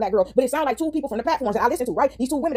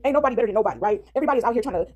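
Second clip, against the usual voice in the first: ordinary delivery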